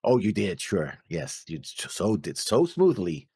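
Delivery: ordinary delivery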